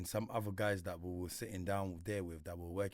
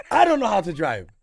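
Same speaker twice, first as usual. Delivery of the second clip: laughing; 10 semitones higher, 16 dB louder than usual. Real, not acted. Laughing at brother driving.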